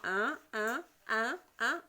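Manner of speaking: "un" said as a separate nasal sound, repeated; phoneme demonstration. Shown as a demonstration. Teacher has pupils repeat the phoneme, "un" sounded out separately. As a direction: model the sound "un" alone, pausing between repeats. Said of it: "Prononcez avec moi".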